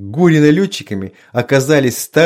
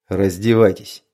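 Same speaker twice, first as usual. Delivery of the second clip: curt order; 6 semitones lower than usual. A narrator's reading. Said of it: "хмурым взглядом и бросил".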